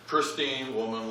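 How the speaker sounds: ordinary talk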